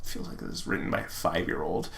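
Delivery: fast, hushed side comment; whispered aside. Real, not acted. Reader whispering to the audience about the fic. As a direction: drop to a whisper to mock the writing.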